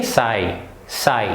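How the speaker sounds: साई said as sai, gh not sounded; gh silent. Shown as a demonstration. Teacher showing children how to say sigh, gh silent. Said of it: "तो क्या बोलेंगे?".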